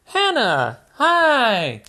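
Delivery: put-on high, cheerful little girl piglet; Peppa Pig voice. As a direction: invite Daddy into the mud, high and bright. Peppa greets Hannah.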